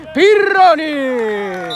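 long, loud drawn-out name; goal shout. Real, not acted. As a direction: hold the name like a goal call. Goal scorer's name called out. (urlo del gol prolungato)

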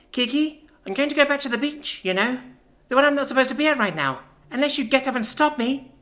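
put-on high childlike voice; a small child's bluff, pitched high; little sister, threatening. Child pretending to leave so her sister gets up, high childlike bluff.

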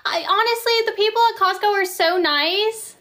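high-pitched gushing disbelief; surprised protest. Real, not acted. Host to viewer who hates Costco job, surprised protest. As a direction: gush in protest, pitched well up.

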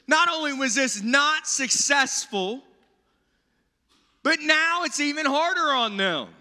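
high, slowed, pleading lament; voicing a distraught Moses; faith crisis. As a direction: plead with God, dismayed. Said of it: "Moses is going through this faith crisis".

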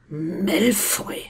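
hissed, suspicious question; drawn-out hostile hiss. A narrator's reading. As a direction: hiss the name with rising disbelief. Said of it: "zischte da der äußerst blasse Mann".

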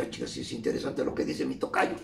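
hushed inner voice, barely breathed; whispered thought. Retold or imitated. Listening to the radio, he whispers his own thought. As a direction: whisper it like thinking to yourself.

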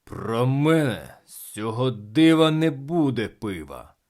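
loud, slow, grumpy retort; angry. A narrator's reading. Captain giving in to the crowd, angry and loud. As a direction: grumble it angrily, drawing it out. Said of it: "капітан сказав сердито".

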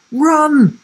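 high, loud warning cry; urgent shout. A narrator's reading. Sam shouts to Will while clambering over the wall, urgent shout. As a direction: shout it out to get him moving. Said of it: "shouted Sam".

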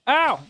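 high loud yelp of pain; sharp cry of pain. Real, not acted. Bitten by the spider, a sharp high yelp.

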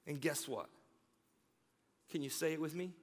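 usual speaking voice

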